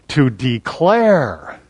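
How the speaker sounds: "declare" drawn out long and slow; stress on declare. Shown as a demonstration. Preacher reading the verse to his congregation, drawn-out stress on "declare". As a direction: stretch "declare" wide, letting it fall at the end. Drawing out the passage's key word.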